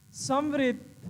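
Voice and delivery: ordinary delivery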